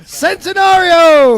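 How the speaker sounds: high, loud, stretched-out holler; drawn-out shout. Real, not acted. Co-host hollering before the break, drawn-out shout. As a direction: bellow it long and high like a rallying cry.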